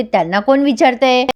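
ordinary delivery

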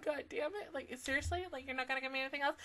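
usual speaking voice

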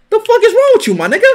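high-pitched exasperated outburst; exasperated yell. Real, not acted. Gamer yelling at player blocking the recording, exasperated. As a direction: snap at him in disbelief.

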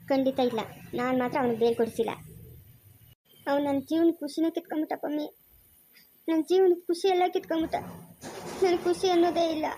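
usual speaking voice

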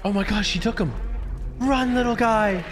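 high, drawn-out cry to flee; panicked urging. Real, not acted. Player urging the little guy to flee, panicked urging. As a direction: call out high, stretching the last "run".

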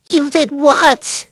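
loud, drawn-out shocked question; shocked disbelief. Acted. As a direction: hit it like you can't believe it. Rehearsing a shocked line.

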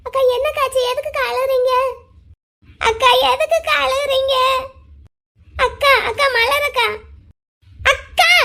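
high, anxious questioning; worried pleading. Acted. Girl finds her elder sister crying, worried pleading.

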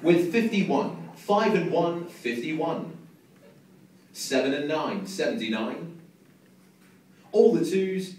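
slow, drawn-out bingo number calls; bingo caller. Acted. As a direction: call each number out, pausing between. Calling bingo numbers to hall.

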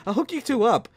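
ordinary talk, nothing marked

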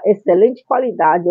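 normal talking voice

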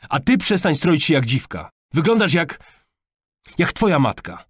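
loud, high outburst, breaking off mid-insult; father's furious shout; angry yelling. A narrator's reading. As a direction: lash out, losing all control. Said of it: "wrzasnął Sebastian".

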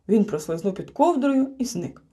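ordinary delivery, nothing marked

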